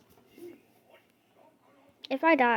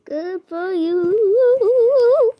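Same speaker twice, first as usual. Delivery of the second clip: very slow, louder, dragged-out mock congratulations; sarcastic drawl; 5 semitones higher, 8 dB louder, much slower than usual. Player mocking another player's win, long sarcastic drawl. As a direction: stretch every word out sarcastically.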